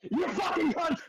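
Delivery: high yelled insult; angry yell. Real, not acted. Player yelling at the teasing friends. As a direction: spit it out in rage.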